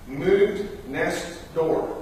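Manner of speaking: slow, drawn-out reading of "Moved next door."; "Moved next door." spelled out slowly like a notice; reading the sign. Retold or imitated. Neighbors' sign read out, dragged out slowly.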